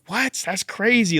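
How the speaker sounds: high-pitched incredulous "what"; mock-astonished reaction; retold astonishment.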